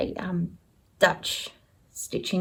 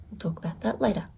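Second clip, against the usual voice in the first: a hushed, unvoiced aside; secretive whisper; 8 dB quieter, much faster than usual. Real, not acted. Whispering a teaser to her viewers, secretive.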